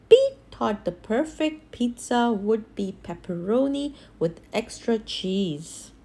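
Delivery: usual speaking voice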